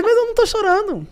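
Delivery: lightly mimicked, high-pitched retort; imitating Lula. President answering a crying militant, mimicked protest. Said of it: "Ele fez".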